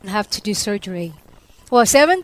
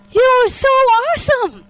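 awestruck exclamation; 13 semitones higher, 4 dB louder than usual. Retold or imitated. Believer greeting Jesus as he waits to embrace her, awestruck. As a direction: burst out high in delighted wonder.

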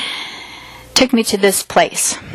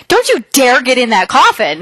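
higher-pitched mock warning, rising on the forbidding words; retold scolding; 4 semitones higher than usual. Friend forbids her the coffin, mock scolding. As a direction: imitate a friend sternly forbidding it.

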